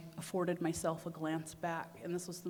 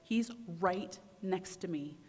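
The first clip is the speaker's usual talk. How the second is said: ordinary talk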